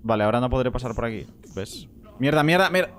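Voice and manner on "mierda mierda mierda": loud, frustrated swearing; frustrated cursing. Real, not acted. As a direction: spit out the curses in frustration. Player gets hurt in game.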